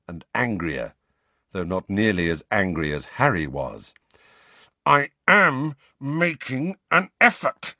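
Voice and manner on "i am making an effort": gritted teeth, tense; through clenched teeth. Acted. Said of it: "he said through clenched teeth".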